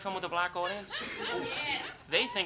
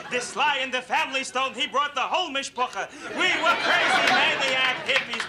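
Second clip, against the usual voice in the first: hammed-up elder reminiscing, high and emphatic; old-timer; 5 semitones higher, 4 dB louder than usual. Old father giving daughter rock history, hammed-up elder. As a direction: stammer and marvel like an ancient storyteller. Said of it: "Giving her the history of rock and roll".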